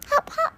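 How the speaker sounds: very high, crying hop hop; squeaky hop. Acted. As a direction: squeal like a hopping bunny. Rabbit hopping.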